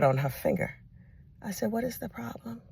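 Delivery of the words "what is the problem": quiet, calm "What is the problem?"; soft question. Retold or imitated. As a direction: ask it gently. Aunt asks the girl quietly.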